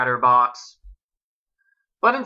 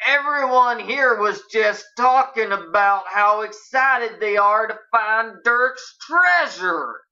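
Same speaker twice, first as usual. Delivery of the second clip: higher, slow and pointed drawl; barely disguised irritation; 6 semitones higher, slower than usual. A narrator's reading. Dave confronts Nancy over the secret, barely hiding irritation. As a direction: lean on it with strained politeness. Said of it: "barely able to disguise his irritation".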